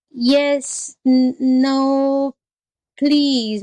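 high, drawn-out hesitant jackal; nervous stammer. Acted. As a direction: waver timidly, feigning confusion. Jackal feigns nervous confusion before tiger.

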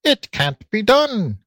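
high, slow piglet voice; declaring piglet. A narrator's reading. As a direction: declare it up high like a little pig. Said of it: "declared Curly".

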